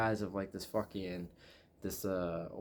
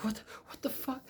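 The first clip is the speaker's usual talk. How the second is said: high-pitched, softer mock disbelief; mock-baffled, pitched way up; acted bewilderment; 5 dB quieter than usual. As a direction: squeak out confusion, imitating him. Acting the bewildered captive man.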